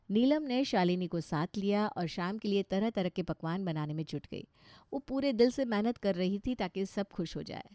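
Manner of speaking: plain reading voice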